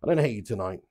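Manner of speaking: ordinary talk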